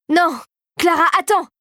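high, urgent pleading cry; an old woman begging the girl to wait, high and pleading. A narrator's reading. The regent begging Clara to stop, high pleading cry. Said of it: "l'implora Mère Gingembre".

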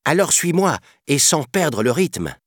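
high, lilting youngster voice; playful young elephant. A narrator's reading. Young elephant inviting the boy to follow, playful. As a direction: invite a friend along in a high voice.

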